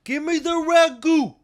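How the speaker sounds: put-on high, slow New York accent; hammed-up New York accent, drawn out. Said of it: "You like my New York accent?".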